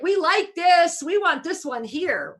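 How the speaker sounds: acted-out gleeful insistence; eager approval. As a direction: cheer for the one you want to keep. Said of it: "The fourth dimension is like".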